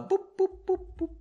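boop boop in a high squeaky pitch. Pretend. Narrator marking a scene break with high boops. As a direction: chirp it like a little sound effect.

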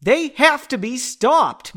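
slow, high, loud declaration; emphatic acted outburst; insistent yell. A narrator's reading. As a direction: yell it with each word weighted. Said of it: "Margot yelled".

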